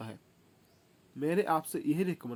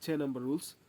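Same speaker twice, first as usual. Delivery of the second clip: normal talking voice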